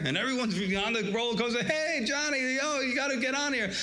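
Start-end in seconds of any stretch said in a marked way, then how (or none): 1.6-3.7 excited calling out. Retold or imitated. Friend on the roller coaster calls Johnny over, excited shout. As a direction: call your buddy onto the ride, thrilled.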